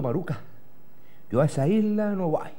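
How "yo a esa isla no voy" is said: normal talking voice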